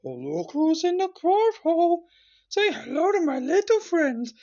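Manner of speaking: very high, loud, slow squeak; hammed-up tiny creature taking charge; shrimp's movie-climax voice. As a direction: squeal a slow mock-menacing taunt. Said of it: "He says".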